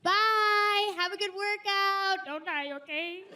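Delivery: high, drawn-out goodbye; sing-song plea to come back safe; anxious goodbye. Retold or imitated. Wife sending her husband off to the gym, high and anxious. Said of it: "I say the same thing".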